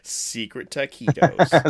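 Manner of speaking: very slow, each word stretched out; savouring. Real, not acted. Relishing the secret snack joke.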